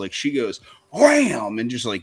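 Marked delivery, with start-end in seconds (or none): 0.9-1.6 loud, high sound-effect word; exclaimed throw effect. Retold or imitated. Dad imitating his baby flinging things, loud and high. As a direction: punch it out like a big hurl.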